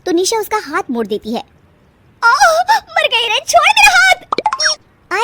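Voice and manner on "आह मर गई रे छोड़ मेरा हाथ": high, drawn-out yelp of pain; a sister-in-law's high, loud cry of pain as her arm is twisted. Acted.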